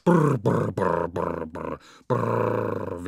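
lips buzzed like a truck engine; imitated lorry engine brr; truck engine rumble. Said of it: "wie Brummi".

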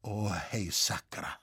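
low, hushed mutter; soft, low, dismayed Bavarian oath; stunned murmur. A narrator's reading. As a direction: mutter a curse under the breath. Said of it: "murmelte in das eisige Schweigen".

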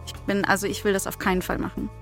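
ordinary delivery

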